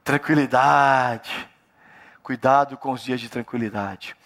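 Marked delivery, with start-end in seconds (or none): 0.0-1.5 drawn-out word. Acted. Preacher savours the word.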